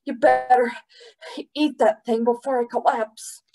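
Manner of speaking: high, breathless warning; winded and straining; huffing. A narrator's reading. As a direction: puff it out, nearly collapsing. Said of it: "Pong huffed".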